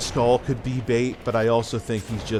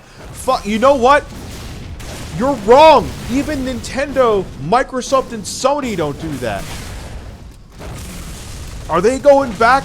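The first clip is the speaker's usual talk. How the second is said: high, loud, exasperated shouting; heated yelling; 11 semitones higher, 6 dB louder than usual. Real, not acted. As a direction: tear into him, full volume. Heated argument, yelling at friend.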